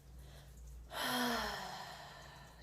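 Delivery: long, airy sigh out through the mouth; deep exhale. Shown as a demonstration. Instructor demonstrating deep breaths to listeners, long exhaled sigh. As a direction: let out a slow cleansing breath. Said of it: "breathe out of the mouth".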